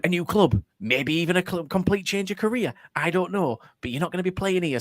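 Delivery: cheerfully breaking bad news; still happy. Manager breezily telling player he's out, still happy. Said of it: "he was still so happy".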